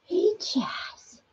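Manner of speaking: soft unvoiced whisper to the pet. Real, not acted. Owner whispers softly to her chipmunk. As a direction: breathe it so he isn't startled.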